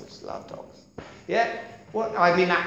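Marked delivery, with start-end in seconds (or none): 0.0-0.8 barely audible whisper; whispered echo dying away; faint whisper. Shown as a demonstration. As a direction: fade it to a breath.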